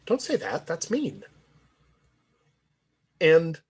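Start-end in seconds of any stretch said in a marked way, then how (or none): 0.0-1.2 higher-pitched, wounded objection; retold hurt, voice lifted. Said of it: "responded from a position of, of hurt".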